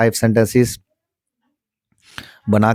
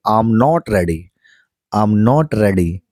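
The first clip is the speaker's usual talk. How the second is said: very slow; much slower than usual. Shown as a demonstration. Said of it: "teacher models sentence slowly".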